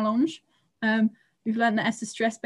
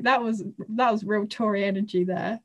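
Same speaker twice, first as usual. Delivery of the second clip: ordinary talk, nothing marked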